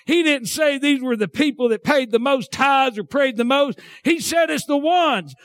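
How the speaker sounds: fervent, high-pitched revival preaching; preaching at full force. Real, not acted. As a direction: pitch climbing into a shouted sermon.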